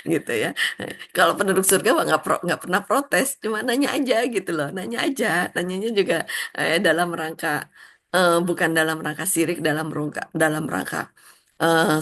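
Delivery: ordinary talk, nothing marked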